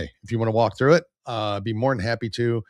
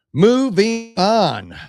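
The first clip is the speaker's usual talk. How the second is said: high, drawn-out segue call; loud announcement; 10 semitones higher, 7 dB louder, much slower than usual. Real, not acted. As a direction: call it out big, stretching each word. Announces a change of topic.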